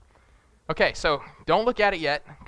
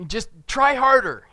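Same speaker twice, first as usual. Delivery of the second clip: mock pep talk; 8 semitones higher, 8 dB louder, slower than usual. Mocking empty encouragement.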